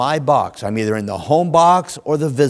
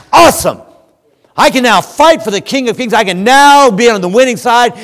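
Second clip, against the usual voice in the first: much higher and louder, a ringing cry of triumph; triumphant shout; 11 semitones higher, 11 dB louder than usual. Acted. Preacher voicing the congregation's attitude, exultant shout. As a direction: shout it out like a believer fired up. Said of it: "your attitude ought to be".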